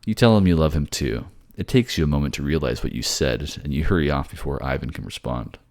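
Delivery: normal talking voice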